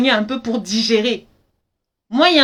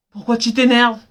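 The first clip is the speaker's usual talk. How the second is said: usual speaking voice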